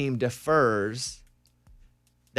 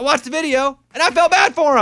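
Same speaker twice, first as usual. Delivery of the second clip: emphatic; 13 semitones higher, 10 dB louder than usual. Real, not acted. Emphatic opinion on podcast.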